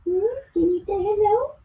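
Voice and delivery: high, sing-song coaxing voice to the dog. Real, not acted. Woman coaxing the dog to say hello, high baby talk.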